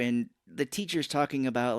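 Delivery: ordinary talk, nothing marked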